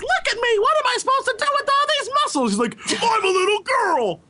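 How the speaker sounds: put-on high squeaky girl voice; little girl voice.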